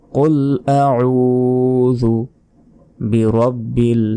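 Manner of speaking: normal talking voice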